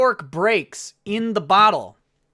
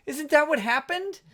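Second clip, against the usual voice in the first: ordinary delivery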